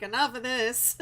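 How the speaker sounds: loud laughing imitation of her mom; laughing mock mom. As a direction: mimic mom through laughter. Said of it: "She's like".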